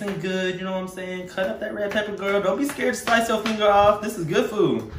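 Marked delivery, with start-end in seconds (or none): none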